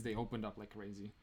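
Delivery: usual speaking voice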